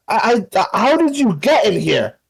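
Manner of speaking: high-pitched, flustered disbelief; startled. Acted. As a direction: stammer in alarm at an unexpected intruder. Startled character confronts an intruder.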